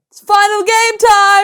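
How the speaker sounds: loud, slow, sung-out game-show call; shouted sing-song. Retold or imitated. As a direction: belt it like a game-show host. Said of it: "you just shouted".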